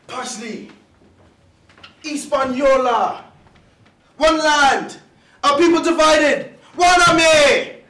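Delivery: loud, high impassioned proclamation; ringing yell, building in force; father's yelled diatribe. Acted. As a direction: bellow it like a dad on a rant. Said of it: "especially when he was yelling".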